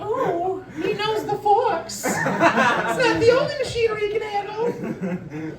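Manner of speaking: high, slow teasing coo; mock flirty high voice. Comic teasing the audience about forklift skills, high slow coo. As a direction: coo it up high like a smitten admirer.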